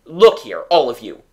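loud, coaxing appeal; entreating. A narrator's reading. Father appeals to his disapproving family, entreating. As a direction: call them round, entreating. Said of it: "he said entreatingly".

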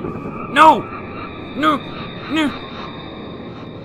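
very high, yelped refusals; panicked cries. Real, not acted. Player scared by the headless doll, panicked high cries. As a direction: yelp it in panic, high and short.

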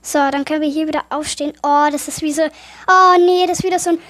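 ordinary talk, nothing marked

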